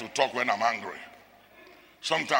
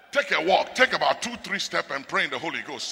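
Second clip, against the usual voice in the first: usual speaking voice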